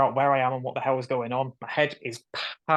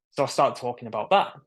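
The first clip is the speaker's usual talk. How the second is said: ordinary delivery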